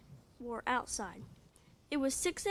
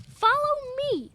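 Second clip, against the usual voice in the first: high, loud holler; 12 semitones higher, 11 dB louder than usual. Acted. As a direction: holler the order. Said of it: "she hollers".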